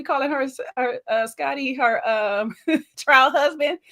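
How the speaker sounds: laughing. Real, not acted. Laughing mid-word.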